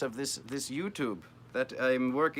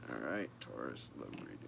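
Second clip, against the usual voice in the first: much lower, softer put-on voice; mock deep voice; 14 semitones lower, 13 dB quieter than usual. Reader announces the Taurus love reading in a deep joke voice.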